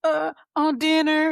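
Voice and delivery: put-on high, loud, slow child answer; a lightly voiced boy choosing dinner, high and loud; imitating her son. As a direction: drag it out like a kid deciding. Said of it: "he was like".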